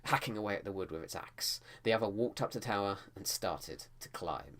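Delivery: Hacking bitten off hard like axe blows; punchy onomatopoeia. Shown as a demonstration. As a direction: make Hacking sound like chopping wood. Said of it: "I've made hacking sound like it's read onomatopoeia".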